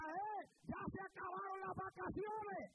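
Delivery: high, slow, sarcastic jeer; mocking shout. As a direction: jeer at the returning brother. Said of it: "le grita". (grito burlón, agudo y lento)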